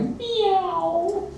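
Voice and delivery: the cat calls to be let in, meow-like. A narrator's reading. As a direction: plead at the door. Cat meows at home.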